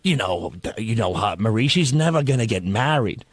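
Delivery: lightly voiced waitress, low and subdued; diner waitress. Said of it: "waitress goes".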